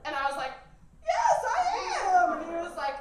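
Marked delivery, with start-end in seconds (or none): 1.0-2.4 high, loud mock wail; hammed-up nervous outburst; blurted confession. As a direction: burst out with it at full volume. Acted confession to dad.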